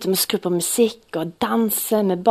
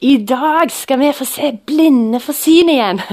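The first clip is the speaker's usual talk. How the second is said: loud, high proclamation; called out; 5 semitones higher, 5 dB louder than usual. Retold or imitated. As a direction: shout it out to the crowd. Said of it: "Jeg bare ropte ut".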